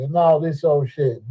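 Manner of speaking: usual speaking voice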